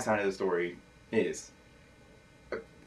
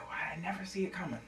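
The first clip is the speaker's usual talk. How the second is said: whisper; 5 semitones higher, 12 dB quieter, faster than usual. Acted. Confiding to stranger.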